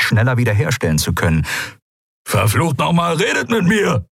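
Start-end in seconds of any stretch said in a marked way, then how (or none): 2.3-4.1 high, climbing angry outburst; exasperated demand. A narrator's reading. Crewman blinded, demanding the others talk to him, exasperated. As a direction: swear and demand an answer, pitch rising. Demands answers from crew amid attack.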